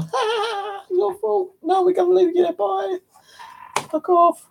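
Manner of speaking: very high falsetto jeering; hammed-up gleeful teasing, high-pitched; mocking laughter. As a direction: taunt in a squeaky mock laugh. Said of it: "they'll be laughing at you, going".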